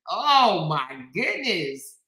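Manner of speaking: ordinary talk, nothing marked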